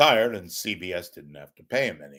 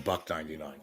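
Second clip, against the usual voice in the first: ordinary delivery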